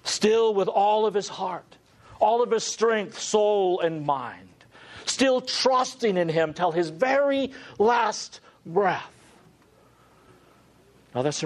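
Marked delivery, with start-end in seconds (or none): 0.0-9.1 impassioned preaching. Acted. Preaching climax, Christ's love.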